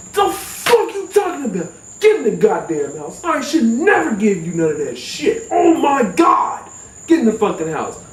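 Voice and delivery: high, exasperated yelling cousin; shouting cousin. Retold or imitated. Cousin shouting at the stoned narrator to get inside. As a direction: yell at him to get inside, fed up.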